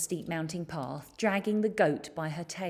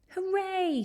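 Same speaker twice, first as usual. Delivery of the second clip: excited shout; 13 semitones higher than usual. A narrator's reading. Boy spotting the monster, excited high shout. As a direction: cheer it out like a thrilled boy. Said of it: "shouted George".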